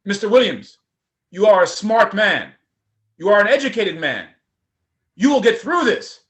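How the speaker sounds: put-on loud Nigerian accent, encouraging; rally him in a Nigerian accent. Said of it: "another Nigerian accent".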